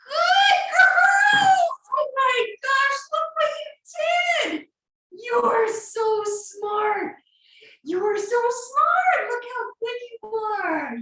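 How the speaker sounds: high, loud, slow gushing praise; excited dog praise. Real, not acted. Praising dog after trick.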